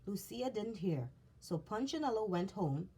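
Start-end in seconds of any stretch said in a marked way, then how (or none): none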